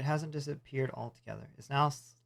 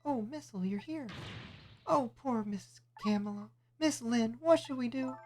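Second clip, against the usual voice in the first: put-on much higher, childlike helper voice; high worried sidekick calling; eager little sidekick; 10 semitones higher, slower than usual.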